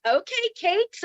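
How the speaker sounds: high-pitched playful self-compliment; admiring self-talk. Retold or imitated. Woman talking to herself in the mirror, admiringly. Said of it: "talking to myself like this".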